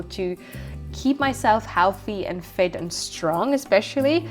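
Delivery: ordinary delivery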